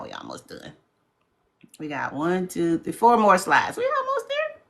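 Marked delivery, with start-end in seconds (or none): 0.0-0.7 whispered, half to herself; whispered aside. Real, not acted. 3.8-4.6 much higher, soft sing-song; squeaky sing-song. Real, not acted. High squeaky reassurance.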